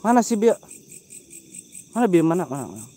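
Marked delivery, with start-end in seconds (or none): none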